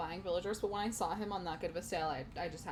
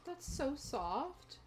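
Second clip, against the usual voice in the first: tender coo; 6 semitones higher, much slower than usual. Real, not acted. Cooing over villager sweeping.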